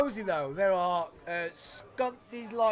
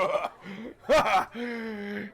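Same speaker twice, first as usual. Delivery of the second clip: hearty barkeep laugh. Acted.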